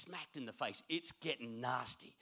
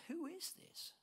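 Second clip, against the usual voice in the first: awed question; 9 dB quieter, slower than usual. Acted. Disciples awed.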